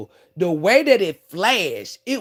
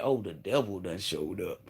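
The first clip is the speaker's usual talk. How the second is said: much lower, much softer muttered warning; low ominous aside; 12 semitones lower, 12 dB quieter than usual. Retold or imitated. To herself about the repo lady, low ominous murmur. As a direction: drop your voice like you've seen the devil. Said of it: "That's why I said".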